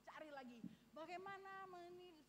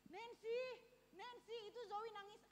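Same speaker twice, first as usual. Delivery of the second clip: a retold call, higher and louder; 8 semitones higher, 4 dB louder, slower than usual. Husband calls his wife that the baby is crying. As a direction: call her name across the house.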